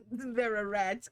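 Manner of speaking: words bubbling out through a giggle; laughing. Real, not acted. Streamer amused by their cheese background idea, laughing.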